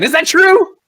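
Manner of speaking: high, loud acted demand; demanding shout. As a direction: fire the question out at full pitch. Interrogator turns sharply to Ben.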